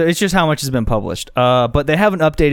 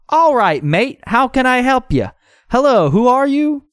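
high-pitched, put-on greeting; neighbour character voice; 11 semitones higher, 3 dB louder than usual. Man greeting visitors at his door, high put-on voice.

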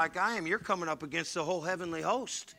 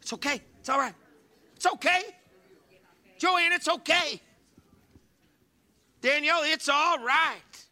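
rising, louder comforting call; reassuring; 10 semitones higher, 5 dB louder than usual. Shown as a demonstration. Pastor shows the church how to comfort a neighbor, reassuring and rising. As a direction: soothe them, building to a high plea. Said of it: "just tell them".

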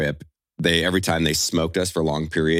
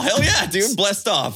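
mock bro; 13 semitones higher, slower than usual. Mocking a bragging free-faller.

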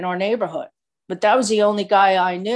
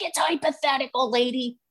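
sassy sister; 5 semitones higher than usual. Retold or imitated. Sister sassing counselor.

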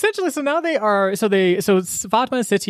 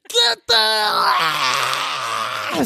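shout; 3 semitones lower than usual. Retold or imitated. Villain shouting at minions.

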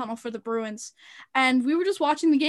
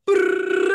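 mock phone buzz; phone buzz imitation; 6 semitones higher, 6 dB louder than usual.

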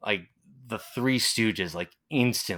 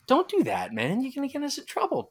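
high-pitched mock panic; retold anxious pleading; worried plea. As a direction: beg a friend to stop, voice way up.

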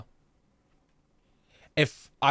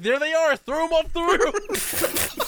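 much higher, slow hammed-up command; mock villain order; 13 semitones higher, slower than usual. Player voices himself ordering a throw off roof, high and slow. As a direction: drag out the order like a cartoon villain. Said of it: "And I was like".

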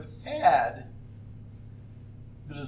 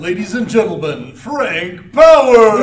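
drawn-out booming higher announcer shout; ring announcer; 5 semitones higher, 17 dB louder than usual. Acted. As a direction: announce him like a boxing match.

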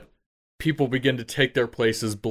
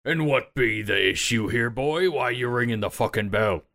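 lightly voiced salty ship's captain; slightly put-on pirate captain voice; sea captain; 3 semitones higher than usual. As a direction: question the boy with a hint of old-sea-dog captain. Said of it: "the large captain".

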